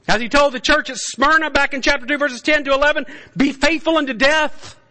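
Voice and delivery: high, loud revival preaching; preaching at full force. Acted. Preacher rallying the faithful, at full force. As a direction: thunder it out over the congregation.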